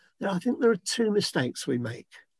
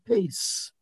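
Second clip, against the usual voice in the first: calm him down gently; hushed soothing words of peace; gentle reassurance. Retold or imitated. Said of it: "God saying to you".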